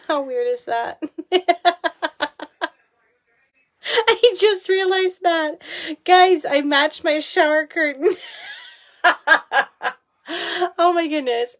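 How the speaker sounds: high giggly laughing disbelief. Real, not acted. Laughing to her viewers about matching the shower curtain, giggly and high. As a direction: laugh it out, amused at yourself.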